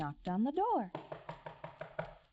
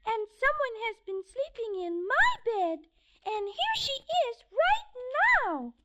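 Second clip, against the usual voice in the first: much higher, tiny bear voice; wee little voice; 13 semitones higher, 5 dB louder, faster than usual. Acted. Baby Bear pointing out Goldilocks, wee high voice. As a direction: squeak it out excitedly. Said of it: "in his wee little voice".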